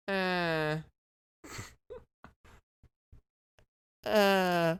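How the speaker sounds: droning mechanical-throat "uh"; mummy groan imitation. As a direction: mimic a mummy's artificial groan. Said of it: "put this mechanical throat in there".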